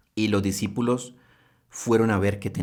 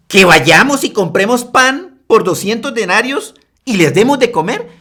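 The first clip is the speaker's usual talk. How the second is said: sarcastic reply; 10 semitones higher, 10 dB louder than usual. Acted. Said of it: "Una respuesta totalmente sarcástica".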